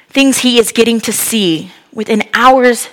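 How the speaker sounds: ordinary talk, nothing marked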